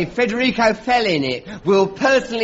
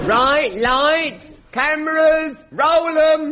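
calling out; 10 semitones higher, slower than usual. Acted. Director calling out.

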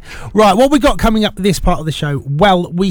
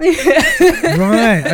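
laughing; 8 semitones higher than usual. Real, not acted. Host laughing at impression.

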